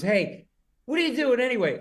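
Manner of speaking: hammed-up drunk heckle; drunk guy calling out. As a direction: call out to the stage. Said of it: "he goes".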